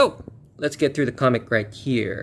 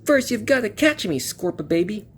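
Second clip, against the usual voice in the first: put-on higher, cocky kid hero; taunting young hero, pitched up; 9 semitones higher than usual. Shown as a demonstration. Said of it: "oh, wrong voice".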